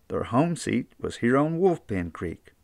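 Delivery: usual speaking voice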